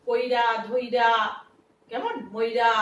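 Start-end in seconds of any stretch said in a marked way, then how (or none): 0.1-1.1 slow, loud কইরা, ধইরা with the i before র; drawn out dialect কইরা ধইরা; East Bengal forms. Shown as a demonstration. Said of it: "পূর্ববঙ্গীয় ভাষায় বহুল প্রচলিত".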